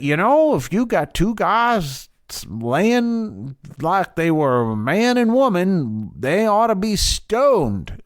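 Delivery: put-on high, drawn-out Southern accent. Laying down Leviticus law, in a Southern accent. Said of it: "that's a Southern accent again".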